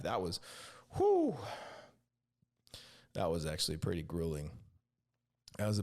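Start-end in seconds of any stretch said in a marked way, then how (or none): 1.0-1.4 high, loud blown-out whew; sharp whew. Real, not acted. Relieved after retelling the burrito ordeal, high loud whew. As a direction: let out a relieved blast of breath. Exhale after grueling story.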